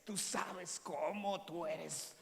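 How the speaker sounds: mock a coach pumping someone up, very slowly; stretched, gushing encouragement; motivational pep talk. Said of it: "animándote, haciendo un coco wash".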